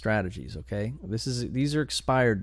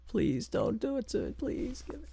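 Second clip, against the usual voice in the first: much higher, tiny begging voice; squeaky pleading; 13 semitones higher, 6 dB quieter than usual. Real, not acted. Begging to win the auction.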